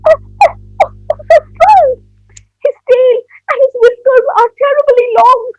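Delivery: high, loud panicked squeal; terrified cry. Acted. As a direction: cry out in breathless alarm at a monster. Child sees a terrifying shadow.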